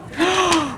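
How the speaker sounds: sharp high gasp; dramatic gasp. Acted. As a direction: gasp in shock. Dramatic gasp after shootout.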